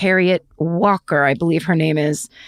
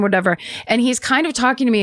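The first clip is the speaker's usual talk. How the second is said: normal talking voice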